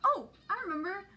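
a hint of an eager little puppet; Lexi puppet voice. Puppet Lexi answers the question.

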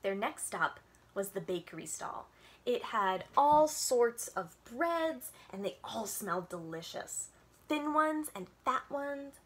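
gentle storytime read-aloud with little voices; reading to children. A narrator's reading. Librarian reading a picture book to kids on Zoom, storytime voice.

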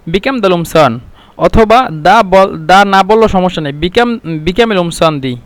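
usual speaking voice

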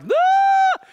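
put-on high shrill-pitched scream like a ten-year-old girl; loud high girlish shriek; girly scream. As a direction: shriek like a scared little girl. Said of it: "I literally scream like a ten-year-old girl".

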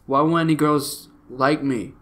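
normal talking voice

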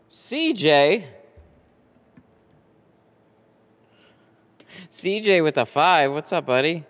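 high, loud hello to a buddy; excited greeting. Real, not acted. Host greeting a buddy who joins the stream, excited. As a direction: call out the name with delight. Greets viewer joining the live chat.

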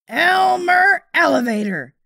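loud, high scolding call of a name; mother yelling at her son; angry mother's shout. A narrator's reading. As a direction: shout his full name like a furious mother. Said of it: "she said to my father".

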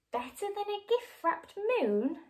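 high, wobbly little question; a hint of a quaver, storybook style. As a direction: let the voice tremble hopefully. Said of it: "Scarlett quavered".

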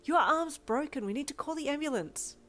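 alarmed. A narrator's reading. Seeing the broken arm.

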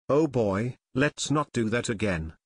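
plain reading voice

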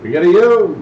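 loud sing-song dice call; craps table caller. Real, not acted. Dealer calls the roll.

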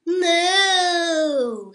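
loud mooing cow. A narrator's reading. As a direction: moo like a cow, full voice. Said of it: "said Cow".

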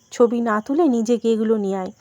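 plain reading voice

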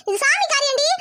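normal talking voice

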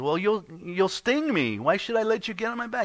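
high, slowed then quick frog voice, suspicious; a nervous little frog refusing the ride; wary frog. Acted. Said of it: "the frog looks at him and says".

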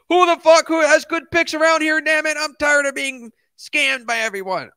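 high-pitched exasperated yell; loud, whiny outburst, fed up; frustrated shout. Retold or imitated. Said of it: "He's like".

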